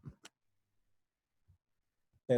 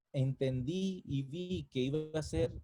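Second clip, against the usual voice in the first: ordinary delivery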